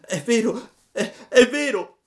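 high, loud sobbing cry. A narrator's reading. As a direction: wail it out through tears. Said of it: "gridava Pinocchio piangendo".

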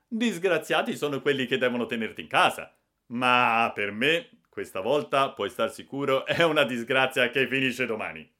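ironic and stern. Acted. Brother-in-law mocking the boy, loud and ironic. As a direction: sneer it with loud irony. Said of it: "L'accento ironico di mio cognato".

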